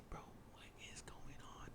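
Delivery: very soft, slow whisper of disbelief; scared whisper. Retold or imitated. Storyteller recalls asking in the car, scared whisper. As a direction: whisper it as if barely able to speak. Retelling scared question to other kids.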